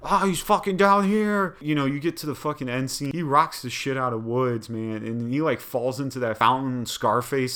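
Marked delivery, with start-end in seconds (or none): 0.0-1.6 higher and louder, hammed-up discovery; mock excited shout. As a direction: yell it out like you just spotted him. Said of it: "He's like".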